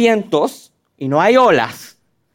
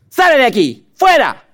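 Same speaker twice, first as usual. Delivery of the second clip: sharp rising command, louder; casting out demon; 6 semitones higher, 4 dB louder than usual. Acted. Jesus driving out a demon, hammed-up forceful command. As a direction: order the demon out. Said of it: "perdón".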